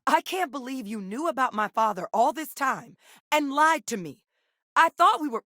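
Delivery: high-pitched, wounded reproach; indignant and let down; hurt accusation. Acted. Acted confrontation over a lie.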